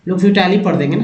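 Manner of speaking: ordinary talk, nothing marked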